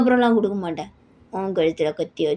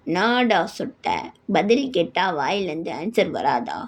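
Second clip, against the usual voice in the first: lower, slow menacing threat; 3 semitones lower, slower than usual. Acted. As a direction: drag the words out to scare the father. Jungkook threatens father. (தாழ்ந்த குரலில் மெதுவான மிரட்டல்)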